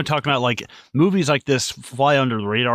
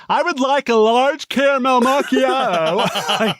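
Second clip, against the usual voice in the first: higher, slow, mouth wide open, laughing; imitating a loud-mouthed guy; 8 semitones higher, slower than usual. Mocking how that guy orders coffee, mouth wide open and slow. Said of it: "that guy talks like that".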